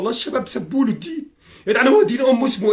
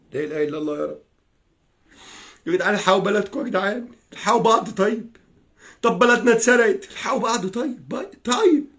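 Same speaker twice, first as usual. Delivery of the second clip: ordinary delivery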